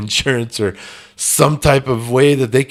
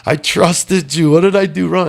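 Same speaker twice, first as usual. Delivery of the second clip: retold scream; 7 semitones higher, 4 dB louder than usual. Man alone at his desk screaming at one who betrayed him. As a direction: drag out the betrayed yell, pitched up high. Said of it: "and I'm screaming".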